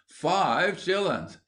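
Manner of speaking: high, very slow outcry; aggrieved exclamation. Acted. Ginger rues the money lost for nothing, aggrieved. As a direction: dwell on each word in dismay.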